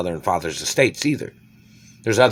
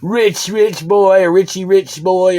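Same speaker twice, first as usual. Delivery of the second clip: movie quote; 10 semitones higher, 5 dB louder, slower than usual. Retold or imitated. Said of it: "takes his phone and says".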